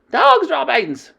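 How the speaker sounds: high, loud, slow Dog's droppings!; witches shouting. Acted. The coven shouting about the smell, disgusted. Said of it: "they shouted".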